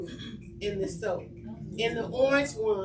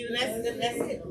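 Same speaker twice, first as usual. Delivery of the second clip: normal talking voice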